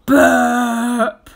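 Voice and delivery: loud belching burp; booming burp after a gulp; big burp. A narrator's reading. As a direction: let out a huge burp. Baby swallows the cat.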